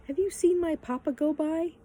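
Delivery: put-on high-pitched girl's question; girl asking. A narrator's reading. Girl asks the children about her papa, high voice.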